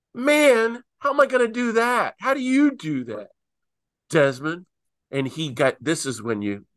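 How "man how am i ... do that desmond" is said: exasperated plea. Retold or imitated. John to Tutu, asking how he could ever manage that, exasperated. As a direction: throw up your hands in mock disbelief.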